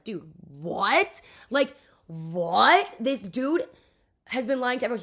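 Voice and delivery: loud, drawn-out incredulous "what"; imitating a dumbfounded friend, loudly; acted disbelief. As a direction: stretch each "what" upward in mock shock.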